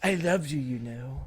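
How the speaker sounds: creepy impersonation, dropping away at the end; Cypher. Acted. Cypher professing love to Trinity, mildly creepy. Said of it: "like, creepy".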